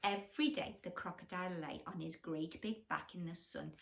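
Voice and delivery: plain reading voice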